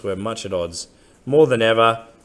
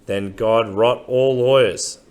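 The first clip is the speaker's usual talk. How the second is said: slow reading aloud; 3 semitones higher, 5 dB louder, slower than usual. Acted. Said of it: "he read".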